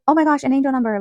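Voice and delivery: high, loud amazed exclamation; excited reaction, imitated. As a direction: re-enact a girl's thrilled discovery. Quoting girl's excited reaction.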